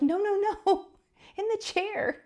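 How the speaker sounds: high, laughing mock husband voice; laughing husband quote. Husband correcting his wife, voiced high through laughter.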